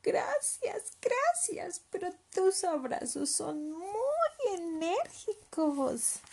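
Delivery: high small voice, slowing down; high, drawn-out childlike protest; little leopard. Acted. Cub politely declines hugs.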